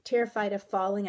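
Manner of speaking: ordinary delivery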